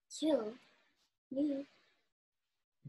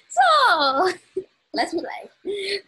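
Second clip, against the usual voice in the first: very slow, drawn out through laughter; laughing; 4 semitones higher, 6 dB louder, much slower than usual. Real, not acted. Starting to play with her friend, laughing. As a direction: laugh the words out.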